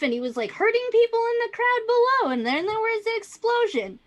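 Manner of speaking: high, emphatic Karen voice; insistent upset witness. Acted. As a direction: insist on it, swooping up in agitation. Plays the distressed witness.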